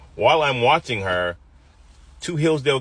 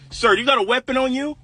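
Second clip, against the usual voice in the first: mock cop threat; 14 semitones higher, 3 dB louder than usual. Officer unsnapping weapon, threatening.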